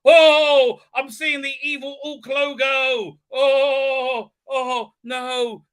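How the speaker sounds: high, loud melodramatic dread; over-the-top wailing alarm; mock alarm. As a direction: wail in hammed-up horror. Mocking a character's dramatic alarm.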